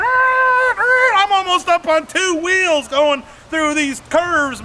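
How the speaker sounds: wild-eyed storyteller whooping about taking curves on two wheels; excited shout. Retold or imitated. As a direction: yell it like you're skidding on two wheels. Hairpin turns at high speed.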